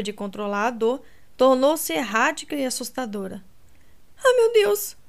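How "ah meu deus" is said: high, louder pained gasp. A narrator's reading. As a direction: gasp it out, breath ragged.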